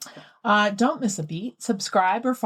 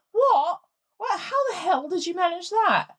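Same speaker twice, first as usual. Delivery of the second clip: shocked; 7 semitones higher than usual. Real, not acted. Shocked at the mint up a nose.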